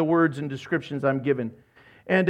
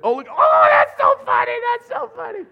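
much higher excited cries; delighted; 6 dB louder than usual. Retold or imitated. God delighted by the prayer, gushing. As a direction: gush it with delight.

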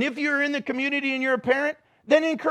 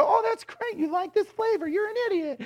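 high mock-cheerful voice turning into a put-down; sarcastic reply; 10 semitones higher than usual. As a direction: fake delight, then the jab. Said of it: "And I go".

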